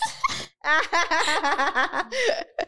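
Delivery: long high giggle; laugh. Real, not acted. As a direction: keep giggling, high-pitched. Rires après la demande. (long rire aigu)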